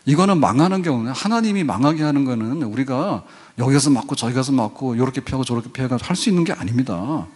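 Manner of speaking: ordinary talk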